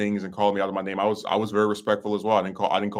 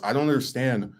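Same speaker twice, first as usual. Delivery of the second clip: ordinary talk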